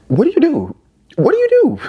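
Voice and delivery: high, disbelieving repeated question; incredulous. Acted. To the viewers, imagining the spouse's plan: stunned high disbelief. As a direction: ask it in shock, pitch climbing.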